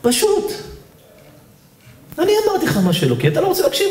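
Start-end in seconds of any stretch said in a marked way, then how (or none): 2.2-3.9 high, whiny mock arguing; defensive protest. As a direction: argue back in a high voice. Acted back-and-forth argument. (התווכחות מבוימת בקול גבוה)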